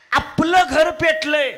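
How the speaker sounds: urgent cry. Acted. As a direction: pitch jumps high and loud, drawn out. मुलगा घर पेटल्याचे ओरडून सांगतो. (घर पेटल्याची बातमी वडिलांना ओरडून सांगणारा मुलगा, उंच आवाजात हाक)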